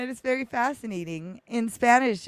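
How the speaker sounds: ordinary talk, nothing marked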